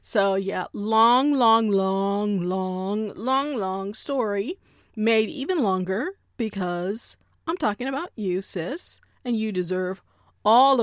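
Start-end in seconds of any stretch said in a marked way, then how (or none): 0.7-4.6 "long" stretched over and over; drawn-out repetition. Real, not acted. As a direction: draw out each "long" into the next. Playfully stretches the word long.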